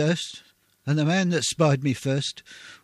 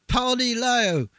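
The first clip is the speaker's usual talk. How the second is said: calling out; 8 semitones higher, slower than usual. Acted. Soldier calling for the water-carrier.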